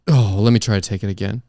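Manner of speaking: usual speaking voice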